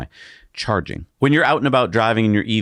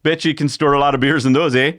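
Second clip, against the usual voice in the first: a higher, hammed-up Canadian accent, heavy on the eh; mock Canadian accent; 7 semitones higher than usual. Host picks the cargo bins, in a mock Canadian accent. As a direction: play a beer-loving Canadian. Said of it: "not all Canadians talk like this".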